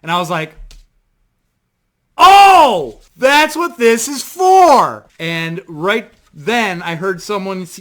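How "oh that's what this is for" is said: high, booming, drawn-out "oh" of discovery; loud realization. Retold or imitated. Fan finding the band in the tent, loud realization. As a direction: shout it slowly as it dawns on you.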